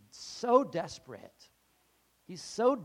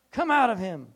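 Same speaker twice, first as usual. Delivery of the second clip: loud, higher commanding cry; mock exorcism command; 6 semitones higher, 11 dB louder than usual. Preacher playing exorcist, loud command. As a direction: cast the demon out with force.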